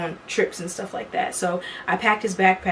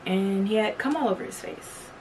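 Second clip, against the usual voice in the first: usual speaking voice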